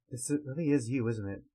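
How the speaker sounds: usual speaking voice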